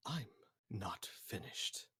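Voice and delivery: whisper. Acted. Slash cuts in on the captain with a whisper. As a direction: breathe it out, cold and threatening.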